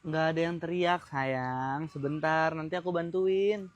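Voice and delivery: low, soft soothing; quiet, low and patient; calm reassurance. Acted. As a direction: reassure your partner gently. First take, he soothes.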